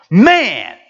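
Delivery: mock burst of excitement; excited. Preacher re-enacting joy at a new computer, excited. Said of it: "And I get excited".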